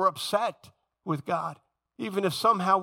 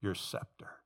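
softer, with your scepter near whispered; a lowly, pleading prayer on the face; a hushed prayer of submission; humble plea; 7 semitones lower, 8 dB quieter than usual. Retold or imitated. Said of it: "Then I say".

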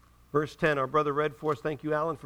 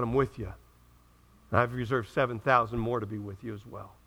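softer, lower voice of God; gentle reassurance; 9 semitones lower, 4 dB quieter than usual. Retold or imitated. God quietly sending weary Elijah back, soft and low. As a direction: speak it low and kindly, like a calm father.

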